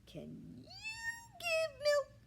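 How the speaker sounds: very high loud squeal; squealing pig. A narrator's reading. Ernest squeals at the hen in disbelief. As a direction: squeal it in outrage.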